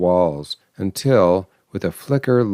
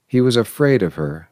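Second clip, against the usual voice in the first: plain reading voice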